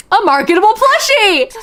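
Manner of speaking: high, loud, thrilled "A marketable plushie!"; excited repeat. Real, not acted. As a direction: shout it back with glee.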